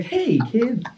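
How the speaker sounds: high, cheesy superhero voice; hammed-up Spider-Man. Spider-Man answers the kid who called him fat, high and hammy. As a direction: play the jolly hero brushing it off.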